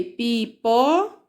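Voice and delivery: "pi, pó" as separate, drawn-out syllables. Shown as a demonstration. Teacher sounding out found syllables, "pi, pó" slowly, one by one.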